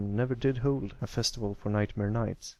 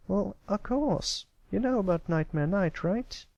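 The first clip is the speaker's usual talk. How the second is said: can't believe he hasn't heard; incredulous filly; 10 semitones higher than usual. Acted. Apple Bloom surprised he doesn't know.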